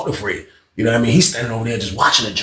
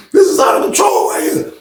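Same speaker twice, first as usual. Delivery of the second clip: laughing disbelief. Real, not acted. Narrator laughs.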